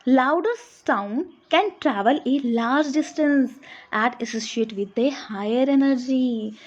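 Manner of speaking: very slow, word by word, for notes; dictation pace. Shown as a demonstration. Teacher dictates a definition to students, very slow dictation. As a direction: spell it out slowly so students can copy.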